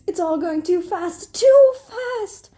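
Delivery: overwhelmed, climbing to a shriek; panicked. A narrator's reading. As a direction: escalate to a loud cry.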